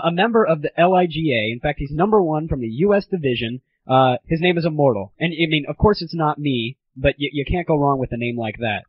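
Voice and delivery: ordinary talk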